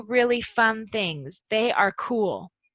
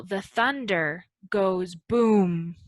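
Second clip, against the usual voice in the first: slow example; 2 semitones lower, slower than usual. Shown as a demonstration. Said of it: "for example".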